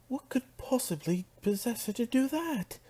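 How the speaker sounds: high-pitched, horrified disbelief; shrill, incredulous Rarity; aghast. Acted. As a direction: question it in appalled high voice. Rarity reacts in horror.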